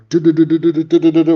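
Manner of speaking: high, loud rapid-fire "du-du-du"; imitated dodging noise. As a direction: rattle off an action-scene sound effect.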